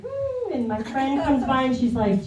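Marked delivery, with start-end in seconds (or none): 0.0-0.5 mock woo. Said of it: "everything's like".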